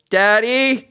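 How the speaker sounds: high, loud call of a daughter; daughter's yell. Acted. Daughter calling to Jerry from the roof, mimicked yell. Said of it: "his daughter from the roof yells".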